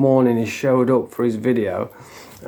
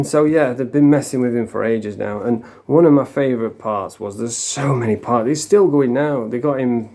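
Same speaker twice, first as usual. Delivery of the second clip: ordinary delivery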